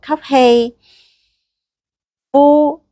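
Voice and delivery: stretched inhale cue, lifted exhale; slow breath counting. Shown as a demonstration. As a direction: guide the breath slowly. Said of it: "好，吸氣". (慢慢拉長嘅呼吸口令)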